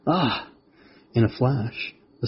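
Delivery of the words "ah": high, effortful creature voice ending in a cry; struggling. A narrator's reading. Spider wrestling the writhing mantis, high struggling voice. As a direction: get it out mid-struggle, then cry out. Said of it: "the spider struggled with the writhing mantis".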